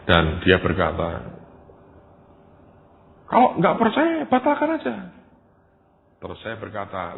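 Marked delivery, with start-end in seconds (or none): 3.3-4.9 loud, high-pitched angry retort; furious outburst, imitated. Accused man to the messenger about the revival, imitated fury. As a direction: imitate a man exploding in indignation. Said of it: "Marah sekali".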